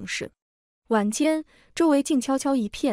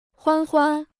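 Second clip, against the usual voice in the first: higher-pitched call after someone; 5 semitones higher, 4 dB louder than usual. A narrator's reading. Mother calls after daughter leaving the table, calling out. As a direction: call the name out from behind. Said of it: "母亲在后面喊我".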